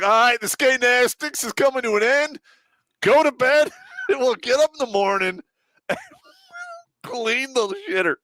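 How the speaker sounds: ordering grown men off to bed, mock commanding. Retelling dismissing the soldiers.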